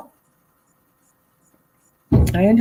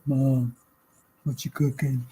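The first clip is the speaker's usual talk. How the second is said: lower, softer mock teenage boy; teen son voice; 4 semitones lower, 6 dB quieter than usual. Teen son drifting in when plates are ready, low mumble. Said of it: "see 'em come through".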